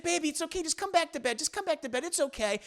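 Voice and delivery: high, softened mock-wife voice; coaxing wife. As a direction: coax the husband in a put-on high voice. Wife coaxes husband back to bed.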